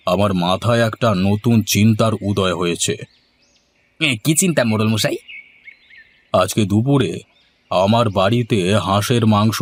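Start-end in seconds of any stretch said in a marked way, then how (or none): 4.0-5.2 high, fast wheedling protest; higher whining toady. Acted. Nagen begs the headman not to lend money, higher whining. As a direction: whine him out of giving money, higher and quicker.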